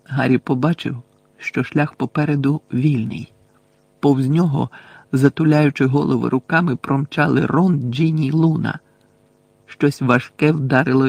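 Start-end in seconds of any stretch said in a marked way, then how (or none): none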